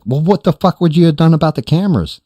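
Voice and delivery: high, loud, incredulous; retold alarm. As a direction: act out an alarmed demand for an answer. Retelling his alarmed question to friends.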